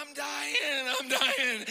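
hamming up a dying moan through a laugh; mock dramatic. Pastor mocking his own emotional outbursts, laughing and hammed-up. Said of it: "I'll say stuff like".

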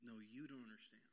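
softly voiced loving father; a hint of a tender father, low and soft; quietly as the father; father's gentle voice. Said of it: "Here's what the father says".

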